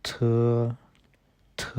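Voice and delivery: थ said as an aspirated dental th; थ, the Chinese t sound. Shown as a demonstration. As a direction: puff air after थ. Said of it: "इसको हम बोलते हैं".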